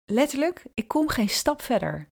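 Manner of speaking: normal talking voice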